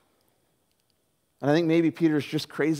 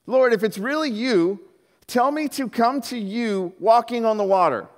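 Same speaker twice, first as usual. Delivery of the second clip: 1 called out; 6 semitones higher than usual. Acted. Peter calling out to Jesus.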